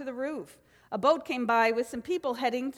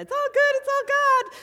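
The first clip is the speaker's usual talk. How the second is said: much higher, louder sing-song "It's all good, it's all God."; sing-song mockery; 14 semitones higher, 8 dB louder than usual. Minister lampooning a phrase she hates, in a much higher, louder sing-song. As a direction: lampoon the phrase in a silly chirpy voice. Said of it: "You know how I hate when people say that".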